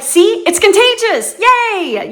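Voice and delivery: high, loud cheery see and yay; cheerful. Retold or imitated. Telling how strangers smiled back at her and her husband, cheerful. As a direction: celebrate like it's catching. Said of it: "And it's like".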